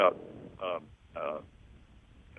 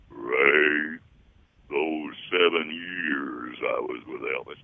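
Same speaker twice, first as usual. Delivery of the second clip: low, slow bass singer; deep bass voice; 7 semitones lower, 3 dB louder, much slower than usual. Retold or imitated. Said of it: "But he says".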